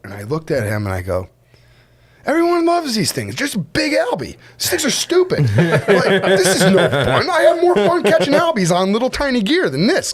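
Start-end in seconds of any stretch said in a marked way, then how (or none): 2.2-10.1 high, scoffing and laughing; laughing mock complaint. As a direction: play it unimpressed, giggling.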